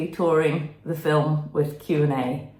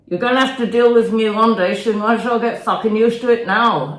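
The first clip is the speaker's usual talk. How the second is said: louder, higher, fast angry rant; aggressive rant; 6 semitones higher, 8 dB louder, faster than usual. Acted. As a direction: snap at her to get used to it, fast. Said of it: "that's the stuff".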